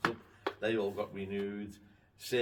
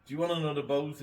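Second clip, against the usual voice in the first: higher, louder mock-Scottish call; Scottish accent; 7 semitones higher, 7 dB louder than usual. Harbour master offering Gav another boat, in a Scottish accent. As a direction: put on your best Scottish accent, calling out. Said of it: "in his best Scottish accent".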